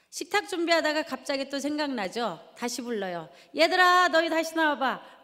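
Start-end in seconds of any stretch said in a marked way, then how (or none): 3.5-5.2 loud, high call across the house. Retold or imitated. Mother calling kids back out, loud and high. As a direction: shout for the kids to come back.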